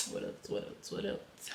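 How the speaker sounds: muttering to herself. Real, not acted.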